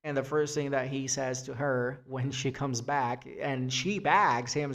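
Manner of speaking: normal talking voice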